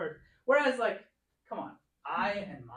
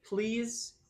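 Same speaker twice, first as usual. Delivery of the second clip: ordinary delivery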